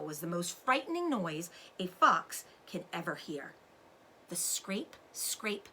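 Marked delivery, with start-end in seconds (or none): 4.3-5.7 soft scraping sound. A narrator's reading.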